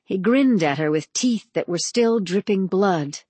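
ordinary delivery, nothing marked

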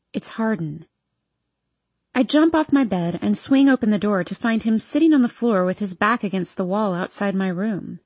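ordinary delivery

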